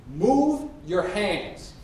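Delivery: very slow, word-by-word order; exasperated demand. Acted. Bob orders Carrie off the toilet tank, slow exasperation. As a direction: spell it out slowly, losing patience.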